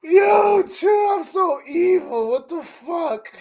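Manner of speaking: high, drawn-out wail through laughter; helpless laughing. Real, not acted. Streamer laughing at being so evil, high helpless laughing. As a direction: squeal it out, cracking up. Cracking up at own evilness.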